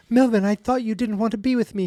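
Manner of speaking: a light falsetto aunt; high aunt voice. Retold or imitated. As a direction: play the aunt up high and gentle.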